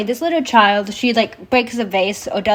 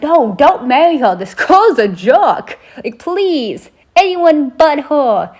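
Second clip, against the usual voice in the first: loud, higher, drawn-out protest; exasperated pleading; 5 semitones higher, 4 dB louder, slower than usual. Retold or imitated. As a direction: plead in playful exasperation, stretching the words. Her exasperated plea to the frog prince.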